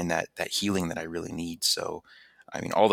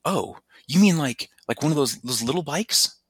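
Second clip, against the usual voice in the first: friend's voice; 7 semitones higher, 7 dB louder than usual. Retold or imitated. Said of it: "he goes".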